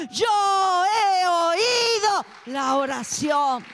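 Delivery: loud, drawn-out prophetic proclamation building to a full shout; voz de Dios proclamando. Acted. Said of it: "la palabra del Señor dice". (proclamación profética a gritos, cada vez más fuerte y alargada)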